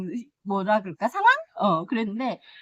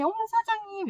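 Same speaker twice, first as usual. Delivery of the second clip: high-pitched delighted "oh my"; delighted surprise at the shopkeeper; surprised exclamation; 11 semitones higher than usual. Retold or imitated. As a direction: gasp it out like spotting treasure. Greeting the shop owner in surprise.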